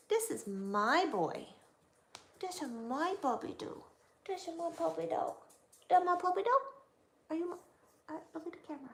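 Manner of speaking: high, sing-song puppy talk; talking to a dog. Real, not acted.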